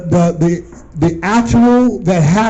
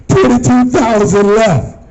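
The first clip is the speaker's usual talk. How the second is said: higher-pitched, emphatic disbelief; incredulous exclamation; 3 semitones higher than usual. Acted. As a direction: exclaim it as an alarming loss. Repeats figure, amazed for scared man.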